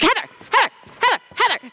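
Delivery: put-on high, insistent kid calling her name over and over; pestering boy. Imitating seventh grade boy Ian interrupting a conversation. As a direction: nag for attention like an impatient seventh grader. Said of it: "seventh grade boy, he's like".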